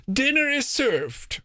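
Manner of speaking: very high, slow maid's announcement; firm announcement. A narrator's reading. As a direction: announce it high and drawn out, like a maid of honor. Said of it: "The maid of honor announced firmly".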